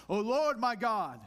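overly formal church voice on "O Lord my God"; stiff and pompous. Shown as a demonstration. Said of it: "a stiff, pompous fashion".

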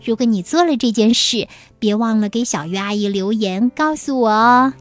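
plain reading voice

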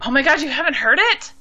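real disbelief, pitch jumping up; astonished. Real, not acted. Host learns co-host hasn't heard the song, astonished.

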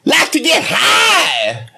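drawn-out high-pitched shout; calling out. Acted. Host asking the crowd who likes to get high, calling out. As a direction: holler it slowly to a crowd.

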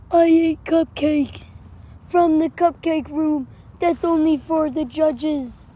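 high, loud confessing voice; truth teller voice. Acted. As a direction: blurt the confession, strained. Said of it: "It'll change your voice".

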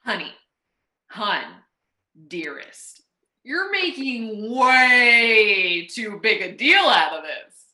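put-on hammy boyfriend, loud on the big deal; overplayed sweet-talking boyfriend; acted boyfriend. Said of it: "And action".

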